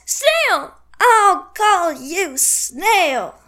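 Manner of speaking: high Snail, then stretched very slowly; triumphant slow taunt. Retold or imitated. Kid retelling Candace's comeback, dragged out slowly. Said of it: "and says".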